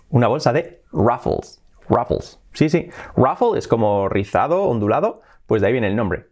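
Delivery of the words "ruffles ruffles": ruffles as "RAF-fols"; English ruffles, not Spanish "RU-fles"; English pronunciation. Shown as a demonstration. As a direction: say ruffles with an "uh" first vowel. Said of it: "se dice ruffles".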